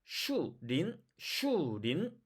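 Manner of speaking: 슈린 as Chinese, with an L in 린; Chinese-style L in 슈린; Chinese pronunciation. Shown as a demonstration. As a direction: separate 슈 and an L-initial 린. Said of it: "얘네들을 한국말로 비교해 볼게요".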